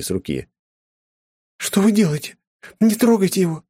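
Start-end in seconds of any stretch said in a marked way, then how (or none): 1.6-3.6 высокий громкий визг испуганной девушки; визгливо крикнуть, заступаясь; взвизгнув. A narrator's reading. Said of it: "взвизгнула аристократка". (взвизгнула)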